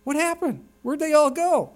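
high, startled mock question; surprised. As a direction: act out looking up and finding the hall empty. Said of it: "I'm like".

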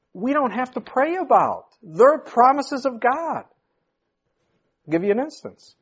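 preaching. Acted. Preaching with raised pitch.

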